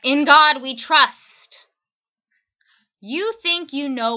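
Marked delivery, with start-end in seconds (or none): 0.0-1.7 loud, slow, every word hammered; all caps shout. Shown as a demonstration. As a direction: read it like it's typed in capitals. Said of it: "in all caps".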